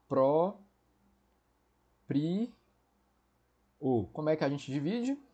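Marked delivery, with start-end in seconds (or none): 0.1-4.1 Pró, pri, o split into slow syllables with long pauses. Shown as a demonstration. Teacher splitting próprio into syllables for students, slow and deliberate. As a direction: spell out próprio one syllable at a time. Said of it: "Então, vamos lá".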